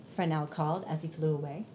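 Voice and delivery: ordinary delivery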